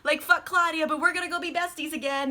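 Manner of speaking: high, quick mock wheedling; deluded pleading. Lestat coaxing Louis to be besties again, deluded pleading. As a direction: plead as if everything is still fine. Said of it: "You know, just his delusion".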